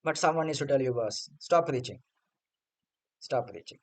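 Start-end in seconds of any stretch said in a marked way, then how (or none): 3.2-3.8 quiet repeat. Real, not acted. Telling a retired cricketer off again, quiet weary repeat. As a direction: lower and much softer, dropped to a near mutter.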